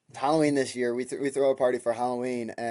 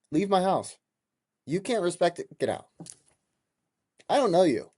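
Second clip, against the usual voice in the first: raised, angry voice; 7 semitones higher than usual. Retold or imitated. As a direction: order him out, furious. Throwing a stranger out.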